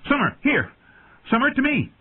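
put-on high, urgent boy's call; high-pitched yell to a beast; shouted command. A narrator's reading. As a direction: call your wolf off urgently. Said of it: "Bran shouted from the high seat".

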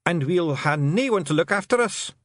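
high Scottish plaint; plaintive Feegle. Acted. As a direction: lament it as a helpless little clansman. Feegle laments being left without carer.

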